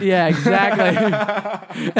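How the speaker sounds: drawn-out laughing yes. Real, not acted. Agreeing with his friend's joke, laughing slowly.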